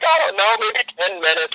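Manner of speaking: high tearful imitation of mom; crying mom. Daughter imitating her crying mother, mild mock sobbing. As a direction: mimic a weepy, broken answer. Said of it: "She's crying".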